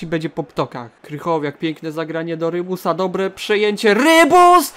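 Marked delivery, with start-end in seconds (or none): 3.9-4.7 high, loud cry of the player's name; excited shout. Real, not acted. Gamer to his striker as he gets the ball, excited shout. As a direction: yell it as the chance opens.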